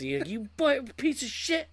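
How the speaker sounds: high, strained mock rage; going nuts. Camper owner raging at the leak, high mock fury. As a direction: lose it at a leaking pipe. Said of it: "I'm just going nuts".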